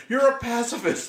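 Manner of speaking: high, loud mock yell; imitating a yell. Co-host acts out the character yelling at Kevin Costner, loud mock shout. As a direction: shout it like the movie scene. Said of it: "he stops him by yelling".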